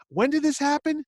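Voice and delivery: high, loud disbelief; alarmed question. Retold or imitated. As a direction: imitate sudden alarm. Chaykin alarmed.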